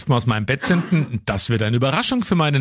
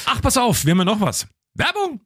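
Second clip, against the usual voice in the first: much higher, rising to a bright call of Werbung; excited announcement; 10 semitones higher than usual. Acted. Host calls the ad break to his co-host, excited.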